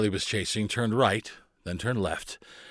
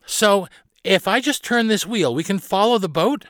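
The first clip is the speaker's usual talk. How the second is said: put-on high-pitched child working it out; puzzled girl captain; 10 semitones higher, 4 dB louder than usual. Carly asks Barnabas about steering, high and questioning. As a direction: ask it high, piecing the idea together.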